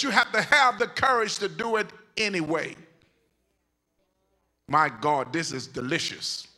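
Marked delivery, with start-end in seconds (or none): 4.7-6.5 savoring aside. Real, not acted. Preacher relishing the message, low murmur. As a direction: relish it under your breath.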